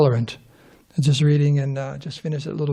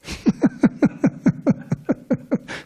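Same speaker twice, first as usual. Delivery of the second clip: long, breathy run of laughter; amused laughter; 9 semitones higher, 3 dB quieter than usual. Real, not acted. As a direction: laugh helplessly at your own punchline. Laughs at his own punchline.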